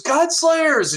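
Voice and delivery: high, loud joyful call; excited greeting cry. Acted. Big Sally greets returning adventurers, excited cry. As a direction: cry it out to long-missed friends. Greeting friends as she runs to hug.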